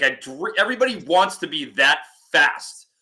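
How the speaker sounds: ordinary talk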